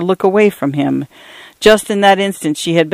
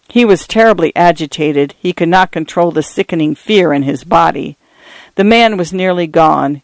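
ordinary delivery

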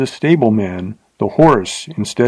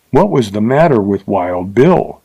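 ordinary delivery, nothing marked

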